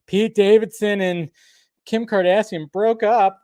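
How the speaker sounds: high, mock-gasping gossip voice; mock shock. Host mocking celebrity gossip fans, hammed-up shock. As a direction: overplay the shock, tongue in cheek. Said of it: "It's like".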